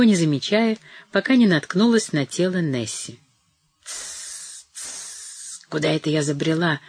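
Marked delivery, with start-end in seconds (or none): 3.8-6.8 hushed hiss then mumbled words under the breath; an old woman muttering to herself, half lost; confused old woman murmuring. A narrator's reading. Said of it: "пробормотала она".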